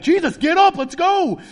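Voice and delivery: high, loud rallying yell; brazen shout. Acted. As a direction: burst in shouting a bold wake-up call. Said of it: "brazen. He's gonna kick in the door".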